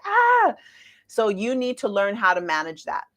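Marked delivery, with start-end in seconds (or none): 0.1-0.5 sudden high mock scream, much higher; a quick imitated scream of alarm. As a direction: yelp it out like a shocked onlooker. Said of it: "they're screaming, they're like".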